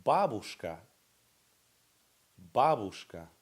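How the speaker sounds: babushka with the stress on BA, said loud and clear; teacher models babushka, stress on the first syllable; model pronunciation. Shown as a demonstration. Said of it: "the emphasis is on the first syllable".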